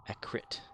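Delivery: "A crit" for accurate, middle U dropped; mimicked mispronunciation. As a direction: softly mimic "A crit", two syllables with no U. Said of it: "leaving out part of the word".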